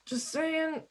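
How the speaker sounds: high, hushed little aside; in-character aside. Acted. Player's character excuses her warning to companions, high aside. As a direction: toss it off in a high, light voice.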